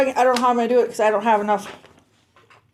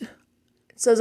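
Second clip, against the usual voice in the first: ordinary delivery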